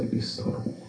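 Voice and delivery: unvoiced, seductive ad-style whisper; whispered product name. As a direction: whisper it like a liqueur slogan. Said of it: "I just wanted to go up and say".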